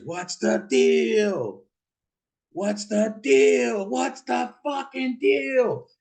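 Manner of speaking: high, drawn-out mock scream; slow, high-pitched hollered impression; imitated screaming. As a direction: imitate a high wail, stretching the words slowly. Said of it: "didn't know why he's screaming".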